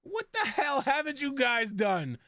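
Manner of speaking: high, drawn-out mock disbelief; retold exclamation. Host retelling Juan's reaction to their welding experience, mock incredulous. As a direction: quote him in exaggerated, slow, high-pitched amazement. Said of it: "He goes".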